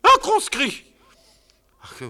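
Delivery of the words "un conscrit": very high loud put-on voice; disguised squeak. Wife disguising her voice as a sentry. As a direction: fake a young recruit's voice.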